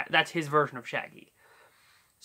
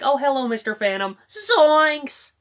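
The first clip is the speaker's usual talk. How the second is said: higher, calm polite greeting then startled yelp; calm Shaggy; 8 semitones higher, 5 dB louder than usual. Retold or imitated. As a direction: greet the phantom calmly, then jump. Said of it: "that's his Scott Innes Shaggy".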